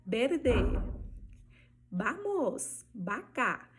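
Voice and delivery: verde, vamos, vaca starting "be-, ba-, ba-". Shown as a demonstration. Teacher listing examples, Spanish V sounding B. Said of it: "ela vai ter som de B".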